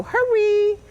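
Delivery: bright, high coaxing "Hurry"; high hurry-up call. Shown as a demonstration. Trainer demonstrating a recall cue, high and coaxing. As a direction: squeak it up to hurry the dog. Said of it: "that's when sometimes we go".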